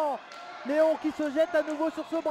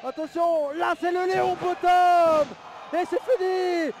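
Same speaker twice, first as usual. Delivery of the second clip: excited shout; 4 semitones higher, 7 dB louder, much slower than usual. Acted. Near-finish in wrestling commentary.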